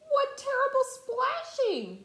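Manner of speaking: high, loud cry of dismay; dismayed elephant. Acted. Horton cries out at the kangaroos' splashing, high and loud.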